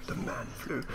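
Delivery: breathy whispered repeat; whispered aside. Real, not acted. Jokey repeat of a remark.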